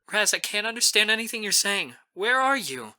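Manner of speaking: high-pitched worried friend; frightened concern. A narrator's reading. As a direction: urgently try to get through to a sobbing friend. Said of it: "Mamie's frightened".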